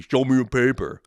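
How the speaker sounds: loud, high barked caricature; hammed-up overbearing official; mock Terminator-like agent. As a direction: bark it as an absurdly overzealous guard. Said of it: "Calm down there, Terminator".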